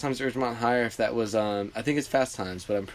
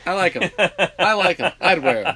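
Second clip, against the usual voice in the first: higher, louder, through a laugh; 7 semitones higher, 8 dB louder than usual. Real, not acted. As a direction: laugh while saying it. Laughing mock approval of shoes.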